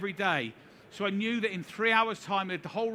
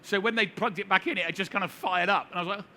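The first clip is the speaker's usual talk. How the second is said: usual speaking voice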